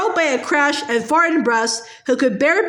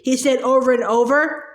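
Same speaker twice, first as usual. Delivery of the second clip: plain reading voice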